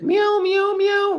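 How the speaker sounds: high, squeaky mock meows; imitating meowing cat. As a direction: whine like a hungry kitten. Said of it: "It's like".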